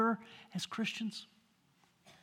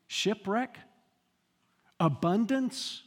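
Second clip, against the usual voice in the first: ordinary talk, nothing marked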